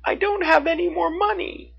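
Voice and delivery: put-on high, tearful child's voice; upset little girl. A narrator's reading. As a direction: plead through tears, upset about the money. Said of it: "she cried".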